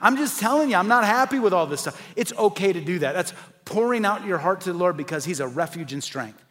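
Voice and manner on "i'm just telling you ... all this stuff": honest venting prayer. Shown as a demonstration. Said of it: "you're just telling the Lord".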